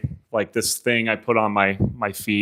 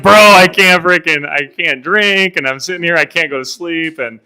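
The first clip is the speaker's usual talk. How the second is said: exasperated complaint; 7 semitones higher, 7 dB louder than usual. Retold or imitated. Runner replaying his complaint about not drinking, exasperated. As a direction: start loud, then ease off, still complaining.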